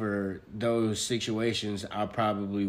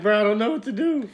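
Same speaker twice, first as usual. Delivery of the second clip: much higher, louder whiny voice; mock panicking kid; 11 semitones higher, 6 dB louder than usual. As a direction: squeal it like a helpless child. Friend voices panicking child.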